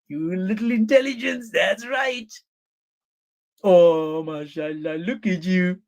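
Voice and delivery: mock cooing husband. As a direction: coo mockingly as if to a pet.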